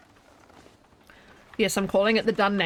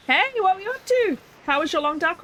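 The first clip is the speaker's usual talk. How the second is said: excited high greeting; 9 semitones higher than usual. Real, not acted. Greeting a raider.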